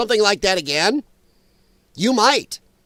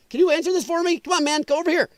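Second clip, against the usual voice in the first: much higher, pleading imitation; put-on phone-call voice; 9 semitones higher, faster than usual. Netanyahu asking someone to take the dreaded call, high mock plea. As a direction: act out someone begging another to pick up. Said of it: "I had a wrong accent".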